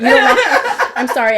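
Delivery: loud, laughing sarcasm; laughing mock apology. Real, not acted.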